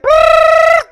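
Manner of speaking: loud, high rolled brrr. Retold or imitated. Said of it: "go get [name] with it".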